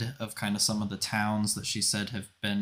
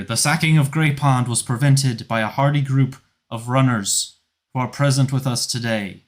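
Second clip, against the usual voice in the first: louder public proclamation; loud announcement; 4 semitones higher, 6 dB louder than usual. Acted. Felane praises the absent runners, loud and formal. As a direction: proclaim it to the whole square. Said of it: "and then you hear".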